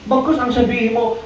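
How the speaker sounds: ordinary delivery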